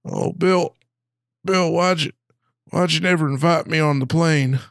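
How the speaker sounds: high, slow, hammed-up woman's voice; a put-on Hillary at a deathbed; mock Hillary. Pretend Hillary saying goodbye to Bill. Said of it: "that's Bill Clinton's".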